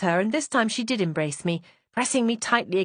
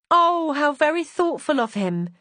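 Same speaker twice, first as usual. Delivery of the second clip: slow, high and louder, swooning with delight; delighted cry; 8 semitones higher, 4 dB louder than usual. Acted. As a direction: gush as if it's the sweetest gift. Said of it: "Winelda cried".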